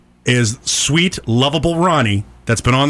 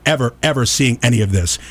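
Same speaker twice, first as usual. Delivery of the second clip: usual speaking voice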